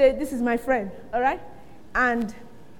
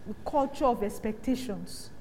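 ordinary talk, nothing marked

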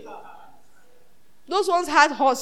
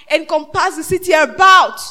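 the verse flung out loud, stress on about; fiery reading of the verse; shouted verse; 7 dB louder than usual. Acted. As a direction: proclaim it to the back row. Verse repeated, now shouted.

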